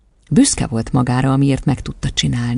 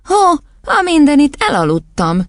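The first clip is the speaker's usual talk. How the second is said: high, loud groan sliding back down; startled groan; 12 semitones higher, 4 dB louder than usual. A narrator's reading. Matilda waking from accidental sleep, groaning in surprise. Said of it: "nyögte Matilda".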